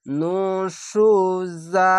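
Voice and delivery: slow, drawn-out chanted recitation; Quranic recitation. Shown as a demonstration. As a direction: recite each word slowly with tajweed. Recited demo.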